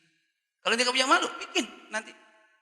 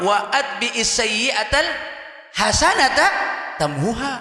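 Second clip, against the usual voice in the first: recited hadith; 6 semitones higher, 6 dB louder, much slower than usual. Acted.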